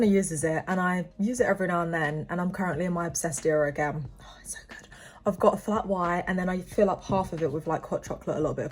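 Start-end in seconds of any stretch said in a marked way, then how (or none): 4.2-4.9 hushed, breathy whisper of pleasure; soft delighted whisper over coffee. Real, not acted. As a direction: whisper it like a secret treat. Savouring breakfast coffee.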